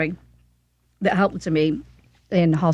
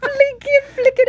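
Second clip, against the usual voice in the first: high excited squeal; 3 dB louder than usual. Real, not acted. Squealing over cute dog video.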